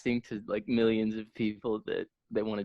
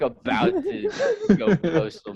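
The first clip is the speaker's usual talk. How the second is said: laughing through the words, a bit slower; 9 semitones higher, slower than usual. Real, not acted. Podcast host laughing about [name] going postal. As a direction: chuckle it out, amused disbelief.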